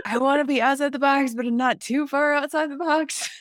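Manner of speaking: high, loud mock of an indecisive person; mock dithering voice. Host teasing her own fear of standing alone, in a high mock voice. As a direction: squeak out the half-hearted rebel.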